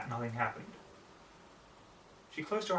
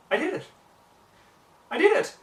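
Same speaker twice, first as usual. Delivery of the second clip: much higher and much louder, bursting with joy; excited exclaim; 12 dB louder than usual. A narrator's reading. Rarity thrilled after freezing the water, shrieking with joy. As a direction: shout it out in pure delight. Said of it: "she exclaimed".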